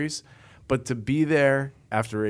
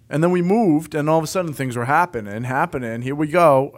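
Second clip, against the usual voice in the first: excited; 4 semitones higher, 6 dB louder than usual. Retold or imitated. Excited retelling of superstition moment.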